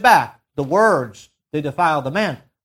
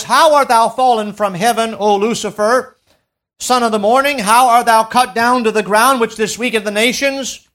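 scripture proclamation; 4 semitones higher, 5 dB louder than usual. Acted.

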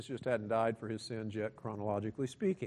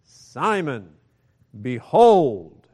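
builds louder to a ringing behold; exclamatory call; 13 dB louder, much slower than usual. Shown as a demonstration. Preacher voices Jesus calling Simon, building to a shout. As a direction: call Simon's name to wake him, then boom behold. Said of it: "this is exclamatory".

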